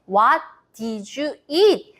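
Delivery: drawn-out, very slow question; slow model phrase. Shown as a demonstration. Teacher demonstrating the question slowly for kids. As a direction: stretch each word so it can be copied. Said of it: "이렇게 표현합니다".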